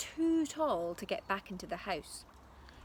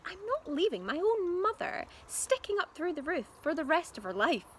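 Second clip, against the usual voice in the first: firm mum; 8 semitones higher than usual. A narrator's reading. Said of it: "Missus Cranky said".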